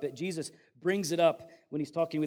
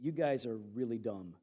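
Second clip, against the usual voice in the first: mock polite; 7 semitones lower, 6 dB quieter than usual. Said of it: "very politely says".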